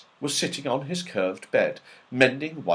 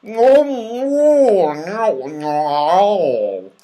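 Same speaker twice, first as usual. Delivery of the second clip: loud, high, mumbled through sticky teeth; mouth full of toffee; 9 semitones higher, 8 dB louder, much slower than usual. A narrator's reading. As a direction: talk with teeth stuck together. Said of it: "unable to speak properly".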